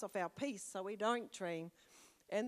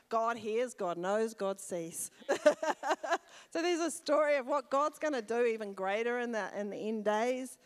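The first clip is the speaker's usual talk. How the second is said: louder proclamation with a joyful laugh; rousing preaching; 2 semitones higher, 7 dB louder than usual. Real, not acted. Preacher declaring God hears to the church, loud and joyful. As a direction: proclaim it with rising joy.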